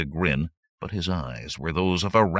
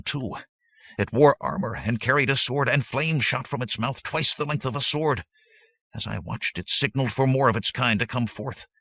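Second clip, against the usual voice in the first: from subdued to higher, fervent telling; gaining fervency; 6 semitones higher than usual. A narrator's reading. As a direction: grow more urgent as the horror comes back. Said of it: "His voice gained fervency as he spoke".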